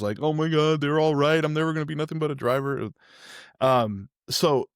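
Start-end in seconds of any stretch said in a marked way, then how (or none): 0.2-2.9 imitating crying. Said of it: "I went out bawling, literally crying tears".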